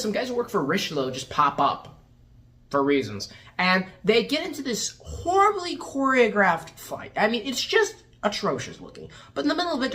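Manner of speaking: usual speaking voice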